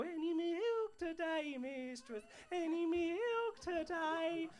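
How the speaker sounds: Oliver street seller. Acted. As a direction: call it out like a bonnet-clad seller.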